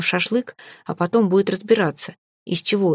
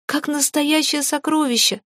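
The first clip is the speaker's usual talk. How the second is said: put-on much higher childish squeal; 8 semitones higher than usual. A narrator's reading. Baby dragon pleading his case to mum, squealing. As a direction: plead shrilly like a little one. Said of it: "верещало дитя".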